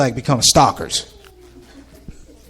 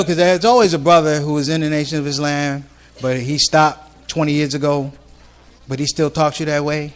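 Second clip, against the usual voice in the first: normal talking voice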